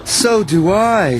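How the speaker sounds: groaning. A narrator's reading. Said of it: "she groaned".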